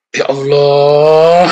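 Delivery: slow, loud, drawn-out "Ya Allah" with a long sighing "Allah"; mock pious exclamation. Preacher mimicking Christians who say it, slow loud exaggerated sigh. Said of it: "Siapa yang suka bilang gitu?".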